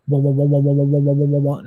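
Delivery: steady repeated "wah" throb; vocal imitation of throbbing energy; imitated pulsing waves. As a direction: pulse it evenly on one note. Imitating pulsing waves felt in hands.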